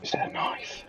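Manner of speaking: soft unvoiced whisper. Real, not acted. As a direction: whisper back conspiratorially. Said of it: "Why are we whispering".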